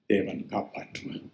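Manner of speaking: soft whispered last words; whispered farewell. Retold or imitated. Dying husband whispering to his wife, barely voiced. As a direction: breathe it out like a dying man.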